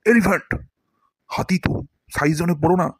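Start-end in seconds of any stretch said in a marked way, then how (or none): 0.0-0.5 "elephant" in one emphatic, falling burst; emphatic "elephant", starting high and dropping; weighty demonstration. Shown as a demonstration. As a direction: boom out "elephant" as a big word. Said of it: "উচ্চারণটা এরকম হবে".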